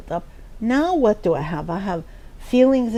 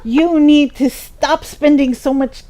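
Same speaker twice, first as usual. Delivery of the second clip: lecturing friend; 2 semitones higher, 6 dB louder than usual. Retold or imitated. Friend scolding her habits.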